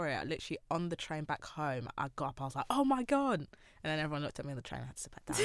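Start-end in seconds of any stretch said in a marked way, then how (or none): 2.7-3.4 a sudden high, loud "oh my God"; replayed shock; excited gasp. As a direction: blurt it out in disbelief. Retold shock on the train.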